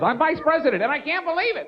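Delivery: high-pitched mock cry for help; Dan Quayle. Vice president calls for help on the alert gadget, mock-distressed. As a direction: wail it in helpless disbelief.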